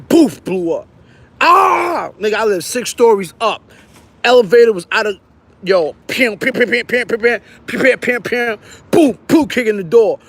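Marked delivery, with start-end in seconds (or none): 1.4-2.1 a high retold yelp of shock; mock scream. Boy yelping as his coat catches fire, high yelp. 6.4-8.6 quick pim pim footsteps; running sound effect. Retold or imitated. Boy running up six flights, patter sound. 8.9-9.5 bang it out like kicking a door; door banging sound. Retold or imitated.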